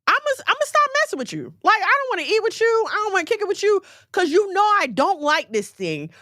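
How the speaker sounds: high-pitched, indignant rush of complaint; fed-up telling-off. Pretend. Speaker to a friend who keeps bringing olives, fed up. Acting out being fed up with offender.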